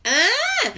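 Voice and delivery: high, loud "ah" of frustration; frustrated cry. Real, not acted. Blanking on the song title live, a high, loud frustrated yelp. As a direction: yelp at her own forgetfulness. Can't remember the song name.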